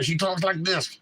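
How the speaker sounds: lightly done clenched-jaw talk, teeth kept shut; teeth together. Retold or imitated. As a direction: mimic Nikki Haley talking through shut teeth. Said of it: "Her teeth stay together".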